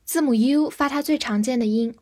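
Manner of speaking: normal talking voice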